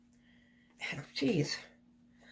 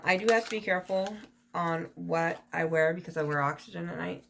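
ordinary talk, nothing marked

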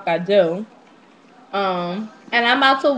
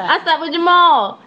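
Jamal punched high and loud; emphatic reveal; 6 semitones higher, 5 dB louder than usual. Real, not acted. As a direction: land the word Jamal hard. Repeats confession, louder.

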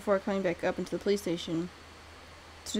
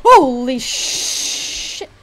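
a drawn-out shout of real fright; startled shout; 4 semitones higher, 16 dB louder, much slower than usual. Real, not acted. Player jolted by a sudden enemy, slow startled yell. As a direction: yell it as the monster jumps you.

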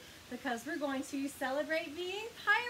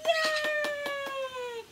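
high, loud happy yay; excited cheer; 9 semitones higher, 6 dB louder than usual. Real, not acted. Cheering after song.

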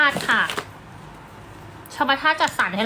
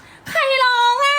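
high, very slow, laughing mock innocence; mock clueless question; 5 dB louder, much slower than usual. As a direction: play dumb about the crying baby. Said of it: "ก็จะแบบ".

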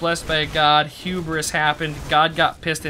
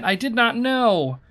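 higher, drawn-out storybook plea; begging; 8 semitones higher, 4 dB louder than usual. A narrator's reading. Renette begging the towering figure, slow pleading. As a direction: plead slowly, lightly acted. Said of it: "begged Renette".

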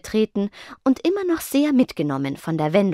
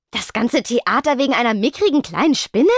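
mild irritated scoff, higher voice; irritated growl; 6 semitones higher, 4 dB louder than usual. A narrator's reading. Venus mocking Spectra's fear, mildly irritated. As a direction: scoff at the fuss. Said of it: "knurrte Venus gereizt".

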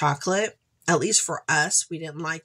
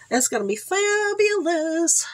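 delighted; 12 semitones higher than usual. Real, not acted. Delight over the eye masks.

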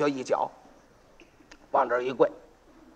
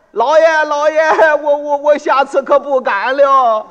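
high, loud stammering plea, slowed; frightened begging; 7 semitones higher, 8 dB louder, slower than usual. Acted. Pot seller kneels before the magistrate, pleading in fright. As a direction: grovel in terror before the magistrate.

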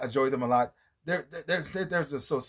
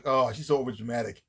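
dismissive mother; 6 semitones lower than usual. Retold or imitated. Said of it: "Her mother like this".

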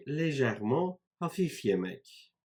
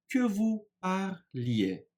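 slow, higher, syllable by syllable; slow teaching model; 7 semitones higher, slower than usual. Shown as a demonstration. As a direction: draw out "parliez" to show the ending. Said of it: "imparfait gibi düşünüyoruz".

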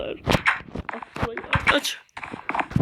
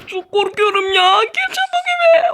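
panicked shout; 5 semitones higher, 5 dB louder than usual. Acted. Panic, shouting to get inside.

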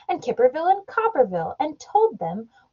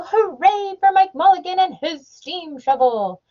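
high, excited crowd voice; cheering crowd; 8 semitones higher than usual. A narrator's reading. The people cheering Mike Mulligan, high excited voice. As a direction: cheer for the digger. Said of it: "shouted the people".

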